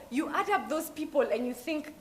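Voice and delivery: ordinary talk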